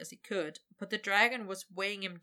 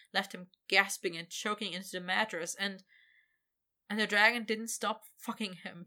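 ordinary delivery

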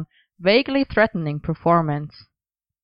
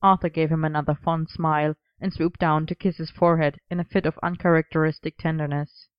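usual speaking voice

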